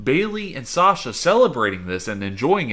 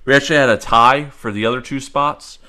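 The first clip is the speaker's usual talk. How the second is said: loud, slow results announcement; announcer reveal; 7 dB louder than usual. Acted. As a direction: build up to the winner.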